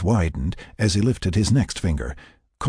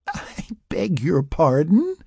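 giggling; 10 semitones higher, slower than usual. A narrator's reading. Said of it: "she giggled".

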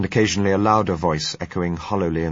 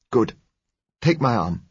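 higher-pitched brisk order; directing; 5 semitones higher, 3 dB louder than usual. A narrator's reading. Blind doctor to his guide, directing. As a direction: give it as an instruction. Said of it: "he directed".

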